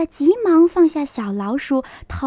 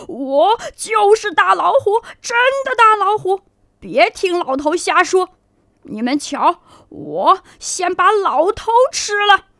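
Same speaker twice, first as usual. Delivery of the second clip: fierce tiger; 6 semitones higher than usual. Acted. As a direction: growl like a bully tiger. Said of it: "凶狠狠地说".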